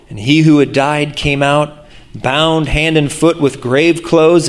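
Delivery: usual speaking voice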